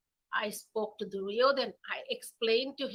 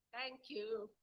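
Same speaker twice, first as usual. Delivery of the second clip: ordinary delivery, nothing marked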